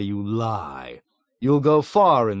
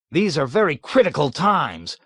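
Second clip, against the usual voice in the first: higher, louder, peevish insistence on very; petulant assertion; 6 semitones higher, 5 dB louder than usual. A narrator's reading. Colonel petulantly insisting from the office corner. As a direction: stamp it out like a sulky boss. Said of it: "asserted petulantly".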